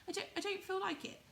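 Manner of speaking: high, fast, hesitant little rabbit; high quick shy toy rabbit. Acted. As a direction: stammer it out in a high small rabbit voice. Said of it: "said the rabbit".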